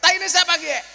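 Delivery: loud, high yell; teriak. Acted. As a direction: yell it out in disgust. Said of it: "Orang ngapak kan teriak". (teriakan keras tinggi)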